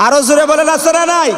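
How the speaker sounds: high, loud demand for response; rousing call. Real, not acted. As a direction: whip the congregation up at full voice.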